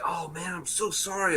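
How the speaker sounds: hushed, high retold apology; mock apology. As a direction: lightly voice an apologetic guy. Voicing the apologetic man who hit him.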